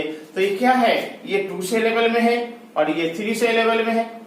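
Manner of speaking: ordinary delivery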